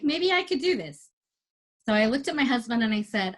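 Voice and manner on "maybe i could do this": higher, dreamy self-talk; a tempted little what-if; hopeful musing. Retold or imitated. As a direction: talk yourself into it, voice lifting higher. Inner thought, tempted to compete.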